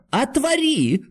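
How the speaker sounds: very high, strained wail; trembling cry. A narrator's reading. Doctor demands the door be opened, crying out. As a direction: cry out in helpless desperation. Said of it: "крикнул Андрей Ефимыч, дрожа всем телом".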